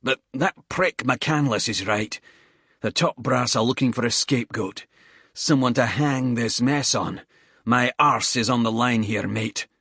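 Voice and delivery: a tired squaddie cursing the climb between breaths; lightly voiced Scottish soldier, puffing; Bald, winded Scottish soldier. Bald, worried about scapegoat.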